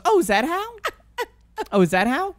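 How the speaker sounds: lightly voiced confused woman asking; mock-baffled partygoer; imitating the is-that-how lady. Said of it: "I named her Is That How Lady".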